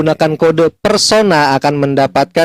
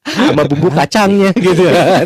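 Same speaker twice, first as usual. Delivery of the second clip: laughing; 3 semitones higher than usual. Real, not acted. Joking about stolen siomay, laughing.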